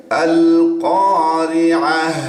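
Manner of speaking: slow recitation, pausing on কারিআহ; stopping form. Shown as a demonstration. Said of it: "এরকম একটা আওয়াজ হচ্ছে". (থেমে পড়া কারিআহ)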